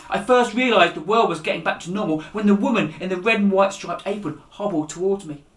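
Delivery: usual speaking voice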